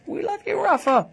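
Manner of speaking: high mock Scouse accent. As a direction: sing it out like a Liverpool fan. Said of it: "in a Scouse accent".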